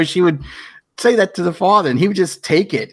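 ordinary talk

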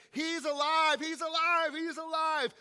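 high, loud, overjoyed shout; joyful cry. Retold or imitated. Women running from the tomb, shouting with joy. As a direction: cry out the good news.

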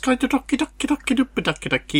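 cute high sing-song babble; high-pitched and cute. Retold or imitated. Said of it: "it's really high-pitched and cute".

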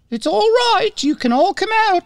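high sing-song coaxing call. Pretend. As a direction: coax them out like hiding pets. Coaxing hidden girlfriends out.

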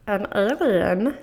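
slow, strange alien voice. Shown as a demonstration. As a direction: channel an alien like Stitch. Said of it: "so that was an alien".

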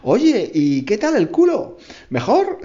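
teasing question, slow then rising high; mock the learner's mistake with a grin; mocking friend. Friend teasing the English girl, mocking rise.

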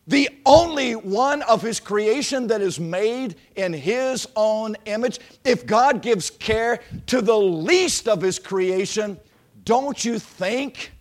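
loud, slow, rising pulpit preaching; preaching at full force. Acted. Preacher voicing Jesus to the congregation, full-force preaching. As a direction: preach it out big and slow to the room.

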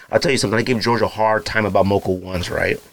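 ordinary talk, nothing marked

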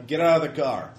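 a hint of a gruff thug; thug command. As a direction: order them out of the car. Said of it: "you hear a man's voice".

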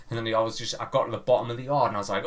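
ordinary delivery

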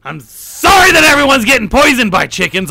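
shouted mock apology; hammed-up Alex Jones apology yell; fired-up apology. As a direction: yell sorry like a ranting host. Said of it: "I'm getting fired up".

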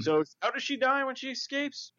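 high, slow questioning voice; mock interviewer. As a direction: ask it like a puzzled fan. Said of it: "they were like".

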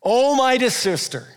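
a mighty wizard's booming proclamation with a laugh at the end; booming like Gandalf. Pretend. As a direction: rise up in power and boom it out. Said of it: "boomed like Gandalf in Lord of the Rings".

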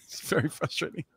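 half the mouth numb, words blurred; numb from the dentist. Real, not acted. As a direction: talk through a numb mouth. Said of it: "I still can't feel half my mouth".

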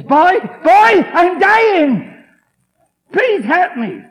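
high, loud begging; desperate plea. Retold or imitated. Dying drinker begs his buddy, desperate and loud. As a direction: beg like a dying man.

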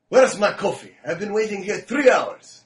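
Jacob. Retold or imitated. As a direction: imitate a mechanic demanding his order. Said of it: "He'd go".

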